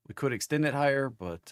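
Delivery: ordinary talk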